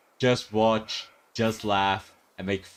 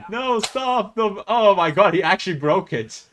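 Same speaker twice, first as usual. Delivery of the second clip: high, loud exclamations of disbelief; shocked outcry; 8 semitones higher, 6 dB louder than usual. Real, not acted. Viewer watching him actually break it, shocked outcry. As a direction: cry out in alarmed amazement. Alarmed as rider breaks bike.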